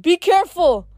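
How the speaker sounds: high, loud warning; urgent call across a distance. A narrator's reading. Father warns his soaring son, calling out. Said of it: "Daedalus called".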